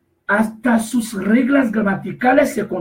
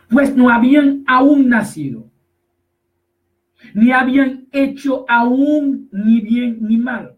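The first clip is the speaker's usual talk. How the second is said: louder, measured scripture reading; lectura bíblica enfática; 3 semitones higher, 4 dB louder, slower than usual. Acted. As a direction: proclaim the verse, drawing out key words. Lee el versículo en voz alta. (lectura solemne y enfática del versículo)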